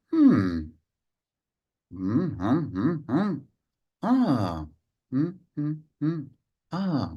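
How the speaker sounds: unhurried mm-hmm rhythm; musing hums. Shown as a demonstration. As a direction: hum slowly, as if thinking it over.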